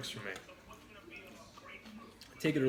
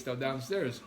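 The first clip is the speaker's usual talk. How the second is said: usual speaking voice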